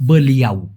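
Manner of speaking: বলিয়াউ said low and clear as a model word; Malay word demo. Shown as a demonstration. Teacher shows learners the Malay word, low and deliberate. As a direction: spell out বলিয়াউ carefully, pitched low. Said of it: "সেটাকে Malaysian ভাষায় বলা হয়".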